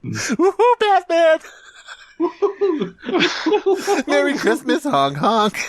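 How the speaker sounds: high-pitched goofy villain cackle; squeaky cartoon goose villain, gleeful; silly clown. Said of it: "it's a character".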